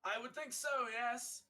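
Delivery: high-pitched in-character affirmation; high, put-on character voice; self-assured character reply. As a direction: answer as the preening warrior, pitched well up. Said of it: "He, he looks himself up and down".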